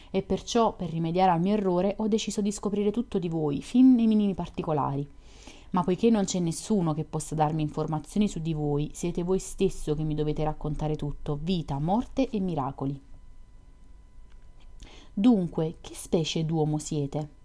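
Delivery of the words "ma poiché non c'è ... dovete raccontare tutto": usual speaking voice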